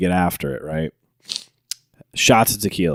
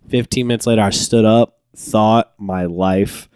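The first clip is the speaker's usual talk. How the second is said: normal talking voice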